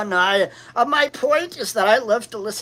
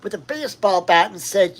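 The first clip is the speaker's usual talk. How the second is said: usual speaking voice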